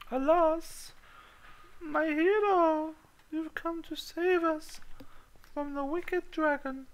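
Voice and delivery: very high, slow, swooning princess voice; damsel in distress. Pretend. Gamer voicing a swooning princess to himself. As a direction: gush like a rescued fairytale princess. Damsel voice answering his alas.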